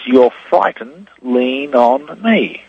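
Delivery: slowly, each word drawn out; slow spelled-out reading. Shown as a demonstration. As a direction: read it out slow and deliberate. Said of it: "and it says".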